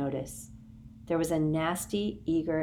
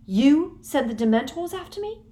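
higher, louder disbelief; stunned accusation; Harry confronting Umbridge; shocked; 9 semitones higher, 7 dB louder than usual. A narrator's reading. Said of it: "Harry in shock".

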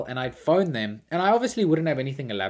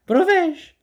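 squeaky high-pitched caller voice; high squeaky prank voice; 12 dB louder than usual. Retold or imitated. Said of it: "I was doing this".

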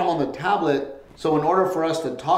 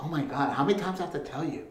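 muttered complaint; 8 dB quieter, faster than usual. Retold or imitated. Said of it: "she's like".

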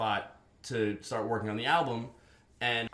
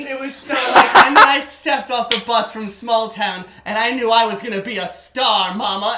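hammed-up dreamer; 8 semitones higher, 15 dB louder than usual. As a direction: belt it like a wannabe diva off the bus. Hamming small-town star dream.

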